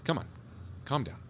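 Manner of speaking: high, hurried giving in; all right, all right, to settle him down; placating. Acted. Officer gives in to pleading.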